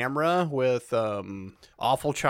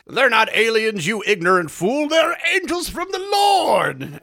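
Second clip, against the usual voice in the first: high, loud, preachy indignation, slowing to a shout; preacher scolding the characters, loud and high; zealous preacher; 7 semitones higher, 4 dB louder than usual. Retold or imitated. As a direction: scold the fools like a fervent preacher. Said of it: "he's like".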